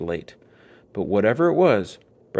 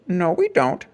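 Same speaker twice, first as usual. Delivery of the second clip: arguing; 7 semitones higher, 3 dB louder than usual. A narrator's reading. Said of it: "argued Papa".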